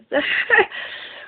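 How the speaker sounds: a quick breathless high laugh; short high laugh. Real, not acted. Laughing bitterly at the conspiracy she uncovered, short high laugh. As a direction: let a little disbelieving laugh escape.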